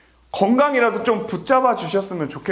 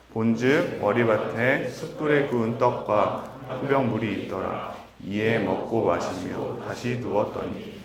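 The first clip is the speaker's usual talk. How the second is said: scripture reading; 7 semitones lower, 4 dB quieter than usual. Acted. Reads scripture passage aloud.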